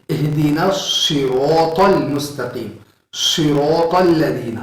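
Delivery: اہدینا صراط المستقیم recited slowly with a whistled ص; Quran recitation demo. Shown as a demonstration. Teacher reciting a Quran example slowly for students. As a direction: chant it slowly, leaning on the ص. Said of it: "سیٹی کی آواز کی طرح".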